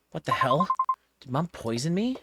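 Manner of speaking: appalled and incredulous; alarmed disbelief. Acted. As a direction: react in startled disgust, pitch rising. Horrified at hair in cake.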